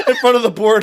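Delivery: high laughing delivery. Real, not acted. Laughing mid-story.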